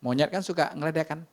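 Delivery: high, urgent preacher's cry; fiery sermon climax, voice soaring; impassioned preaching. Acted.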